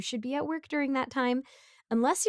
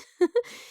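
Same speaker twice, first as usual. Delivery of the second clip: nervous laugh; 10 semitones higher, 4 dB louder than usual. Acted. Awkward laugh after stopping neighbor.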